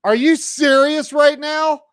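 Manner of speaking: very high-pitched mock disbelief; incredulous. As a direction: play the friend floored, pitch way up. Friend's disbelief, acted.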